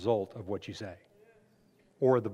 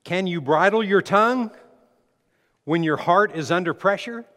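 loud scripture reading; 5 semitones higher, 9 dB louder than usual. Acted. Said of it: "Proverbs seventeen twenty-seven".

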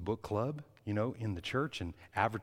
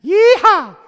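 much higher, shouted Texas yeehaw; Texas cowboy yeehaw; 23 dB louder than usual. Retold or imitated. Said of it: "with my Texas accent".

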